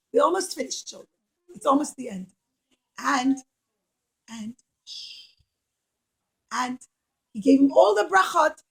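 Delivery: plain reading voice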